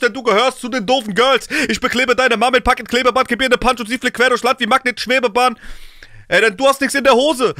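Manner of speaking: ordinary talk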